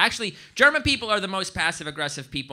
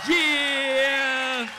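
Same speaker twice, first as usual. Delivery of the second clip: high, long triumphant yell; hyped-up MC holler; rapper hype shout; 8 semitones higher, 4 dB louder than usual. Acted. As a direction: cap the rap with a stretched cheer. Hype shout ending the rap.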